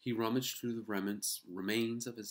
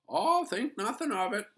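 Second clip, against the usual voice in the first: cheerful handyman; 10 dB louder than usual. A narrator's reading. Matt replies to Ralph.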